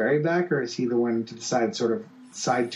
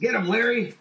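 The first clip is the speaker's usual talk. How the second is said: urging shout; 9 semitones higher than usual. Retold or imitated. Calling out during fight.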